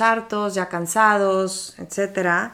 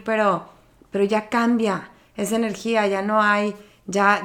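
normal talking voice

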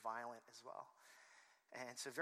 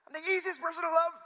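fast, very high, loud yelp; hammed-up defensive squawk; indignant shout; 16 dB louder, faster than usual. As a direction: blurt it out in mock outrage. Repeats [name]'s shout as himself.

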